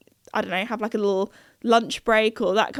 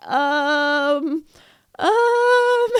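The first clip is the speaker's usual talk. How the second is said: long held um, rising much higher; alarmed hesitation; 4 semitones higher than usual. Retold or imitated. Two friends watch the door go down, alarmed um. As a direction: draw out a worried um. Friends realise shop is shutting.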